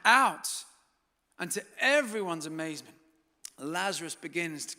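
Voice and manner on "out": loud voice. Retold or imitated. Jesus calling the dead man out of the tomb, loud. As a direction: call into the tomb at full voice. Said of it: "in a loud voice".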